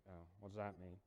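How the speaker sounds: a deflated, baffled crowd voice; confused crowd. Retold or imitated. Crowd confused by Jesus, low puzzled voice.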